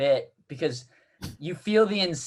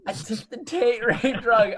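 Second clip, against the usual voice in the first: slow, high, giggly confession; laughing high voice; 6 semitones higher than usual. Retold or imitated. Speaker quoting Reed while laughing, high and slow. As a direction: say it through laughter. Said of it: "he's like".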